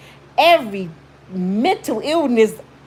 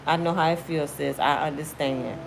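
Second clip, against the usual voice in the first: softer, calm and understanding; softer, understanding friend; 5 semitones lower, 6 dB quieter than usual. Pretend. A friend comforting a heartbroken sister, softer and calm. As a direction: comfort her gently, then hold firm. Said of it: "I'm the kind of friend that say".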